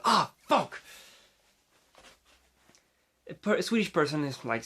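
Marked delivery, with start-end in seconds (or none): 0.0-0.8 higher mock outburst; acted pained yell. English speaker who stubbed a toe, acted yell. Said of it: "can yell, like".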